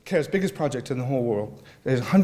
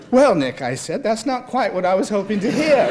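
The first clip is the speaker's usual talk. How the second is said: dismayed retort; 8 semitones higher, 7 dB louder than usual. A narrator's reading. Narrator dismayed by Nick's answer.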